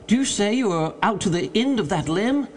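high-pitched urgent question; mild mock scream. A narrator's reading. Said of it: "here fairly screamed Legrand".

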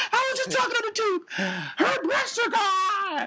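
very high-pitched, hammed-up wail; mock crying. Teasing a friend upset by a call, hammed-up crying. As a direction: bawl it out in exaggerated sobs. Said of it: "you're gonna be crying".

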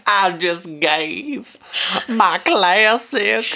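slow, high Southern drawl; southern accent. Retold or imitated. Friend teases her, echoing in a Southern accent. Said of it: "Your southern voice coming in there".

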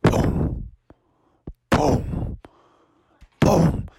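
hollow booms, widely spaced; distant footsteps. Acted. Noise Froggy hears approaching, hollow spaced booms. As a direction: make heavy thuds with long gaps between. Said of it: "it sounded like this".

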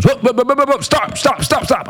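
mock panicked whoa, pitched very high; retold frantic stop. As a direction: cut him off in a hurry, way up high. Buddy halts the talk.

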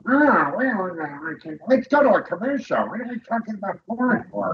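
nervous host hurrying to a commercial; Jay Leno. Retold or imitated. Host mocks the talk-show host cutting to a commercial, flustered. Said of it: "here's Jay Leno going".

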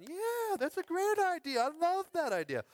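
high, loud gushing approval; mock excited crowd. Pastor mimics the congregation, high and gushing. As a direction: squeal it like thrilled listeners.

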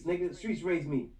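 ordinary talk

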